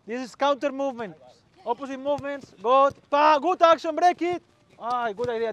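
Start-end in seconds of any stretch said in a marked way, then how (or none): 2.6-4.4 high, loud calls during play; excited shouted encouragement. Real, not acted. Coach praising a good action, shouting excitedly. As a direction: cheer the move on loudly.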